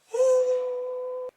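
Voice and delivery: a high startled gasp; sharp gasp. Real, not acted. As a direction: gasp as another booking lands. Bookings pour in.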